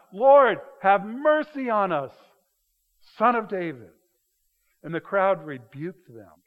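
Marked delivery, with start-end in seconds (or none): 0.1-3.9 loud, drawn-out plea, high at the start; crying out. Acted. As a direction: cry for mercy over a noisy crowd. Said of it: "So they're crying out".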